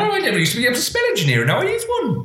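very high, fast comic ad voice; ad slogan voice. Pretend. Friend mimicking an old ad in a squeaky voice. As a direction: squeak it out like a silly advert.

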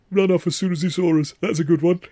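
high-pitched, chuckly aside; tickled by your own joke, voice lifted high; chuckling to himself. A narrator's reading. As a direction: chuckle the words to yourself in a high voice. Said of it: "he was chuckling to himself".

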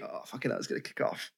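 whispered aside, full of dread; very soft, breath-only mock dread; hushed inner thought. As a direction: mutter it under your breath, bracing for trouble.

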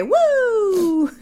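high siren whoop mimicked; a mock police siren; imitated siren whoop. Imitating the patrol car's siren while retelling the traffic stop. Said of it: "Las trompetas".

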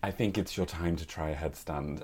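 very soft, low teacher voice; hushed, low-pitched yoga instructor. Retold or imitated. Yoga teacher quoted in a story.

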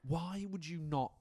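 ordinary delivery

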